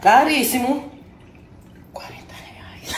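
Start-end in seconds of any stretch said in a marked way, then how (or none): 1.9-2.9 slow whisper; whispered aside. Real, not acted. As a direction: whisper it like a secret. Confessing the cheap price.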